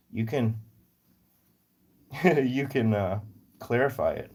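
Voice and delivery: normal talking voice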